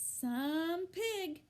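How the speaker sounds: admiring mother. A narrator's reading. Mrs. Arable admiring Wilbur.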